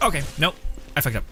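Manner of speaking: normal talking voice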